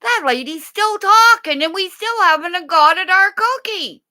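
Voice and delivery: high, fast put-on dog voice; squeaky impatient pup talking; voicing the dogs. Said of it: "they're all probably sitting there going".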